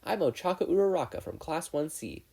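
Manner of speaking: a cheerful schoolgirl voice, lightly lifted; bright girl's voice. A narrator's reading. Girl introducing herself to a new classmate, brightly. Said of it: "The girl let out a bright smile".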